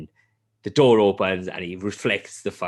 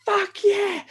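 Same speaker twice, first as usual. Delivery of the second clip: much higher, thrilled exclamation; excited cheer. Retold or imitated. Fan watching Vader finally fight, excited cheer. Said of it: "And you're like".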